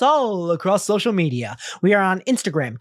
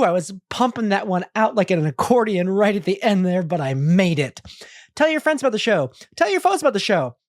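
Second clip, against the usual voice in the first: ordinary talk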